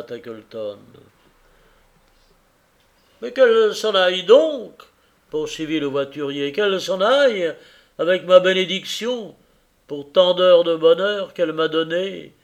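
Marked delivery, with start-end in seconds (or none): 3.2-12.3 earnest husband pouring out his regret; a man blaming himself for his blindness; grieving carrier. Acted. Carrier tells Tackleton of his wife's sorrow, earnest and grieving.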